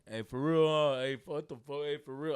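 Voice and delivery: loud, rough-and-ready imitation of some guy; lightly voiced man calling out; man's voice in background. Boyfriend overhearing another man on the phone, imitated man's voice. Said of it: "a man's voice".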